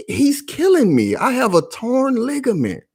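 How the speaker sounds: high pleading mock voice of the woman; imitating the woman. Imitating the woman's high plea for the viewers. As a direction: raise the pitch and plead like she did. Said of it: "She said".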